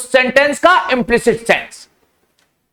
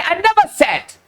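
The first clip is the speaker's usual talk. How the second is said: "never" raised high and emphasised; stress on NEVER; 6 semitones higher, much slower than usual. Shown as a demonstration. Teacher quizzing students on the new meaning, stress on "never". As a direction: swell up on "never", then fall. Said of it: "अब क्या कहा मैंने?".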